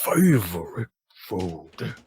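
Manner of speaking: slow-witted character voice, words far apart; ponderous, baffled drinking buddy; Eglith. Acted. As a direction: puzzle it out very slowly, one word at a time. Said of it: "Eglith, what is your favorite food?".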